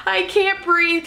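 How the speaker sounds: high, slow, choked-up plea for air; retold crying plea. Mother in labor tells her doctor she can't breathe, through tears. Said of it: "I'm crying".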